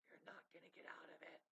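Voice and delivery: a soft threatening whisper; eerie whisper. Acted. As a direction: whisper it close, ominous. Eerie aside in the video game.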